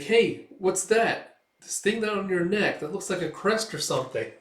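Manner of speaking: lightly voiced inquisitive sidekick; curious elf. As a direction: call out with curiosity, pitch lifted. Curious elf spots a mark.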